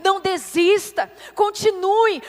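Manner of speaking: loud, urgent exhortation; fervent preaching. Real, not acted. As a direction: drive each phrase home at full force. Preaches at full force.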